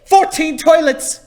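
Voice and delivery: slow, loud mock Irish nun; Irish nun accent. Nun announcing the clogged toilets, in a weak Irish accent. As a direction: drag it out in a half-done Irish brogue. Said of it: "I can't do an Irish accent".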